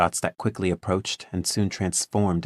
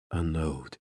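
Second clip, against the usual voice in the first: low and muffled, quiet command; a muffled order, low in the throat; 5 dB quieter than usual. A narrator's reading. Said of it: "came his low, muffled voice".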